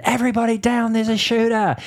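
high yelled alarm; shouted warning. Retold or imitated. Youth pastor yelling at teens in assembly, high shout. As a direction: yell it to the whole room. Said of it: "I just said".

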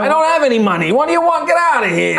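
put-on irritated drunk. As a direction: get rid of them, annoyed.

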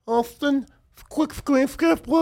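high, hammed-up mocking baby voice. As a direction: mimic his baby voice, way up high. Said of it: "Why you going to your baby voice?".

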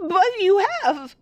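very high, thin frightened voice; panicked elderly woman; terrified old mother. Acted. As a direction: recoil from a daughter she no longer knows. Mother insisting in fear.

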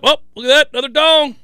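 mock surprise. Acts out watching home runs.

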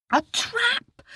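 put-on high, earnest little boy's voice; a small boy calling for help; young boy. Boy answers.

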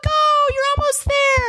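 shrieking fan voice; mock cheering fan. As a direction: fan cheering a wall-hit runner. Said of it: "They'd be like".

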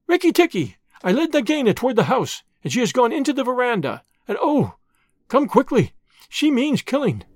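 high-pitched urgent alarm; mild scream. A narrator's reading. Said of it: "heard Darzee's wife screaming".

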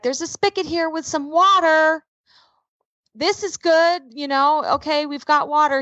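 retold pleased discovery; dad finding water. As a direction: pitch it up as if announcing a lucky find.

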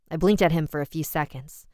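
ordinary delivery

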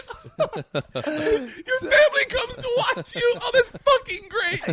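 high, loud cackling shout; laughing disbelief. Real, not acted. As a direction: crack up in disbelief. Incredulous laughter at the story.

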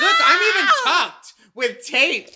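loud, high laughing yell; laughing shout. Real, not acted.

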